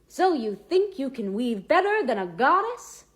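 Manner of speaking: loud, high, swooping incredulous question; mocking challenge. A narrator's reading. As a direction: taunt them like a show host, pitch leaping. Imagined challenge from the gods' punishment show.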